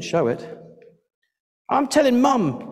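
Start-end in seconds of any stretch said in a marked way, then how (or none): 1.7-2.7 high sing-out tattletale threat; threatening little sister. A narrator's reading. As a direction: threaten your brother in a high child's voice. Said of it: "she threatened her brother".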